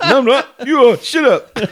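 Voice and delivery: laughing through the words; laughing, can barely get it out. Real, not acted. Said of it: "You're actually crying".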